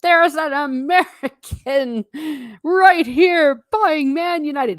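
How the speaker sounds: high, loud, drawn-out jeering call; mock yelling. Stallholder teasing an American customer over socks, mock yelling. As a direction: yell it out slowly for the whole crowd. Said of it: "he started yelling".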